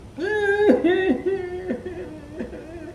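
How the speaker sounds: high hammed-up sobbing wail; mock crying. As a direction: fake a big boo-hoo. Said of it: "she began to cry".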